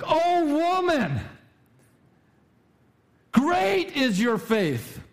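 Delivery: Jesus marveling aloud at her faith; exultant proclamation. Retold or imitated. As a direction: proclaim it high and drawn out, in awe. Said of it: "But he was like".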